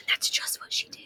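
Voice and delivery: gasp, then whisper; teacher whispering the twist to the class. A narrator's reading. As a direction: hush it like a big secret. Dramatic whispered narration.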